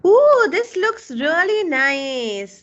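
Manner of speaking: slow, high and loud ooh of delight. Real, not acted. Teacher delighted by a student's design, slow, high and loud. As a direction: savour the praise, drawing it out.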